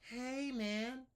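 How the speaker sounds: little student copying; small student. Shown as a demonstration. Student mimics teacher, high. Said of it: "I'll be a small student".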